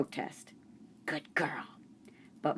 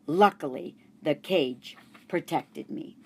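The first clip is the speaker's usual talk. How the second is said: ordinary delivery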